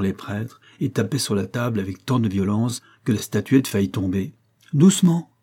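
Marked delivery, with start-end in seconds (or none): 4.7-5.3 high, louder cry of restraint; urgent caution. A narrator's reading. Bouvard restraining a man pounding the table, urgent high call. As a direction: call out sharply to restrain him.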